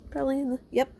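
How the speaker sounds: ordinary talk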